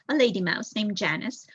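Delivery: ordinary delivery, nothing marked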